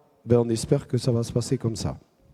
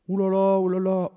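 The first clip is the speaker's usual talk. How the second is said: mock alarm; 5 semitones higher than usual. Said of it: "tu as l'air énervé".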